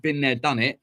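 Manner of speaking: ordinary talk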